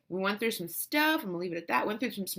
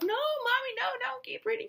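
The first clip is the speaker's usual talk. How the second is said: put-on high, small child's voice; pleading child; 4 dB quieter than usual. Children begging mother to keep reading, imitated high plea. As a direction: imitate a kid begging Mommy. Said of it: "I've offered to them many times".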